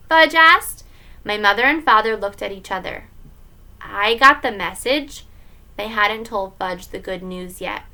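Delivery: plain reading voice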